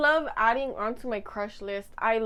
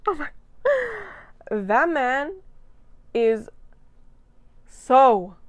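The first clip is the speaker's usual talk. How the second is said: excited; 4 semitones higher, 7 dB louder, slower than usual. Real, not acted. Gushing over a hot man.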